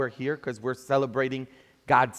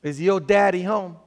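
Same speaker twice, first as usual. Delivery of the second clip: lightly put-on southern accent, raised pitch; southern accent, louder and higher; 5 semitones higher, 5 dB louder than usual. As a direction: ask it in a preacher's Southern accent. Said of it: "This is my southern accent".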